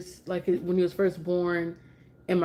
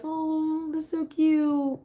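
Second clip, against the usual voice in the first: high, slow gushing aww; 10 semitones higher, much slower than usual. Retold or imitated. Mom recalling cooing at her friend's story. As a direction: coo over how cute it is. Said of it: "I was just like".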